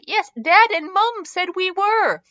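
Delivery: put-on high, slow, proud child voice; drawn-out, high-pitched triumph; triumphant. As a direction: crow it like a kid proved right. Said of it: "Leo said triumphantly".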